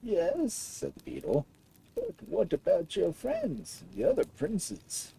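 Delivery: slow, lightly put-on aged beetle; an old creature taking its time. A narrator's reading. Aged beetle tells the prince of Mushroom Land, slowly.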